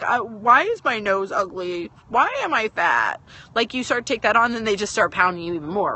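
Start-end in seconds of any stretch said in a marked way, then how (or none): 0.0-3.2 slow, drawn-out mock self-pity; hammed-up woe-is-me questions; bullied victim's lament. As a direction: stretch it out like a wounded victim. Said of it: "you can choose to be like".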